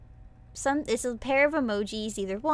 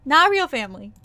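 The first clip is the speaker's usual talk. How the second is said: loud, flustered correction; laughing protest; 2 semitones higher, 11 dB louder than usual. Real, not acted. Vtuber loudly correcting chat about her mom. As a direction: blurt out the correction.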